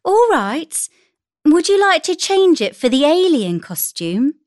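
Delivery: high, lifted mother voice; agreeable mother. A narrator's reading. Mother offering her daughter a swap, agreeable.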